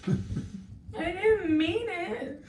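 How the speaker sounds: slow, high pleading voice. Retold or imitated. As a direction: drag it out like a sorry child. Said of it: "I was just like".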